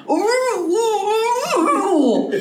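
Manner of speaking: high, hammed-up pleasure moans; playful moans. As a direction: moan it like you're enjoying it. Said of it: "mercy sounds more like".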